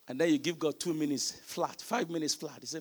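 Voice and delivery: ordinary delivery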